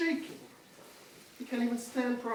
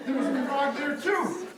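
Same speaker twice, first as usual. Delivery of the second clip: usual speaking voice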